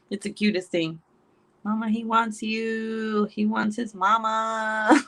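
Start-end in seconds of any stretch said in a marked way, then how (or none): 1.6-5.0 slow, drawn-out imitation of her son; mock child's sing-song summons; child calling mama. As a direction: stretch the words like a pestering kid.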